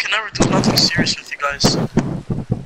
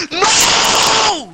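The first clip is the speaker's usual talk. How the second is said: angry yell; 5 dB louder than usual. Real, not acted. Friend trolls him in game.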